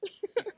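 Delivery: quiet little chuckle; a small soft laugh. Real, not acted. Bride laughs softly.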